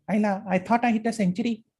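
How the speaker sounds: high, shrill cricketer's voice; Sachin Tendulkar. Retold or imitated. Teacher showing students what a shrill voice is. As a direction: pitch up into a thin, shrill tone. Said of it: "So that is shrillness".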